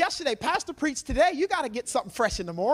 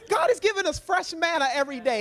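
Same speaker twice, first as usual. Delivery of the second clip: normal talking voice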